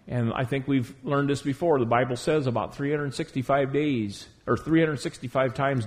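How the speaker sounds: ordinary delivery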